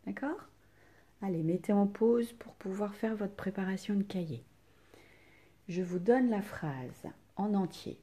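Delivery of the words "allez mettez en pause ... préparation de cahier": whisper. Real, not acted. Teacher instructs class, whispered.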